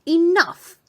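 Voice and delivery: high, loud stern cry; commanding shout. Acted. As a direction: cut the room dead. Abbess halting a quarrel.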